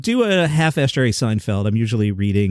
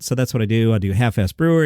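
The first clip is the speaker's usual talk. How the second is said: usual speaking voice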